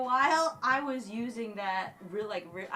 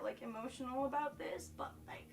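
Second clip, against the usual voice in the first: whisper; 7 dB quieter than usual. Real, not acted. Fighting tears, whispering.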